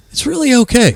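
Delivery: calm her worries kindly; reassuring. Retold or imitated. Reassuring the girl.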